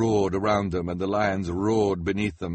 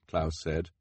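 ordinary delivery, nothing marked

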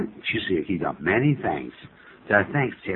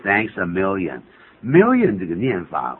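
ordinary delivery

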